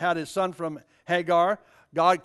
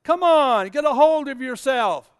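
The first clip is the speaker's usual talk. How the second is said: teasing wife; 5 semitones higher, 6 dB louder than usual. Retold or imitated. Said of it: "I can imagine Sarah saying".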